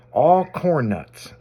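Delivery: slow, drawn-out words; slowly drawn-out quote. Retold or imitated. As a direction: stretch each word slowly. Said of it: "like that, right?".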